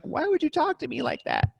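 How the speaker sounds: high-pitched put-on voice; mock-hurt high voice; disguised prankster voice. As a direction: play innocent in a high fake voice. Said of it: "He disguises his voice".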